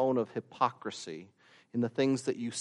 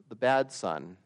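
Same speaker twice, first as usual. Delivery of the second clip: ordinary talk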